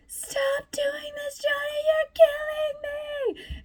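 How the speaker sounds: squeaky, very high and slow, hammed-up crying plea; imitating a theatrical meltdown, stretched out high and slow; mock dramatic wail. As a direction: overplay her hysterical sobbing plea in a tiny high voice. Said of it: "very dramatic, like, starts crying".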